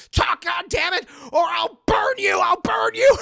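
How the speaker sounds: high, loud, rising shouted threat; furious threat. Retold or imitated. Mother threatens to burn the doll, furious shout. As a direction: scream it over the fire.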